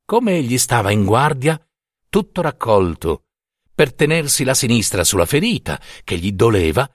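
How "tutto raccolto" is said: usual speaking voice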